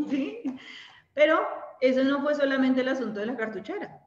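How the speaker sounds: ordinary talk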